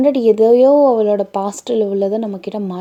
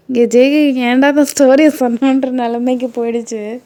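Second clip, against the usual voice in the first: exasperated regret; 4 semitones higher, 7 dB louder than usual. Retold or imitated. Voicing the husband's exasperated regret.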